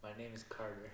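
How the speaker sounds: very soft self-introduction; real hushed, under-projected reply; too quiet to hear. Real, not acted. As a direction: repeat your name without projecting at all. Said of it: "Gotta speak up".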